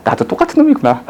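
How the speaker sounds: high, rueful self-mocking cry; mock dismay at catching yourself; half-laughing at own mistake; rueful realization. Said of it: "깨달았어".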